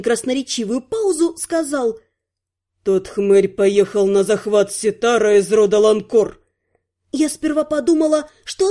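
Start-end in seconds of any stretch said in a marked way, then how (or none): 2.8-6.4 low, grave male delivery; dead serious. A narrator's reading. Prince breaks the news about Terry, dead serious. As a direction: deliver the news with grim gravity. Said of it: "Кристен был предельно серьёзен".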